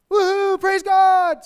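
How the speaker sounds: high, loud hammed-up whoop; mock praise shout. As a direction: shout it like a churchgoer on Sunday high. Mocking Sunday worship hype.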